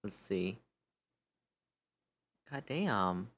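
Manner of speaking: ordinary talk, nothing marked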